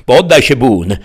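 loud, high shout to surrender; urgent command. A narrator's reading. Deputy demands the outlaw surrender, loud command. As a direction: order him down forcefully.

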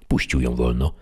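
ordinary talk, nothing marked

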